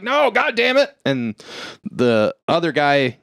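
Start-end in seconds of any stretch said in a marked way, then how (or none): 0.0-1.0 higher, hammed-up anguish; pitched-up melodramatic curse; mock frustrated outburst. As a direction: cry it out like a thwarted hero. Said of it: "and he's like".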